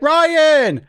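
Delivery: put-on high, loud kid's call. Older boy summoning his babysitter, calling out. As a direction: holler for the babysitter, drawn out.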